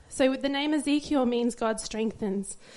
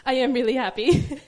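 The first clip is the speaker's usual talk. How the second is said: louder, laughing, back at usual pitch; laughing assurance; 5 dB louder than usual. Real, not acted. Mother reassuring the congregation after her impression, laughing. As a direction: insist it sincerely through the laughter.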